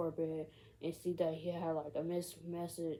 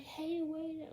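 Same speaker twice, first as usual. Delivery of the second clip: mock calling out; 11 semitones higher than usual. Girlfriend to boyfriend far ahead, mock calling out. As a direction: imitate a girlfriend calling after someone. Girlfriend calls after him, retold.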